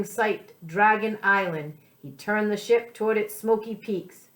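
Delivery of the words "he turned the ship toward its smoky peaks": usual speaking voice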